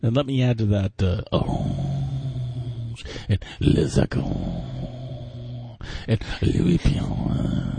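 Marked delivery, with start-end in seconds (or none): 1.3-7.7 very slow, hammed-up French accent on Orange, Les Echos and Louis Pierre; exaggerated French accent. Co-host teasing his partner with an overdone French accent. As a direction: stretch the names out like a mock Frenchman. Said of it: "Your French is so much better than mine".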